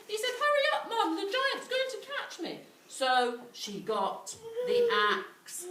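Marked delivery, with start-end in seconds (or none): 0.4-2.6 put-on high, loud, fast panicking boy; panicked boy. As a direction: shout for help as frightened Jack. Jack urges mum.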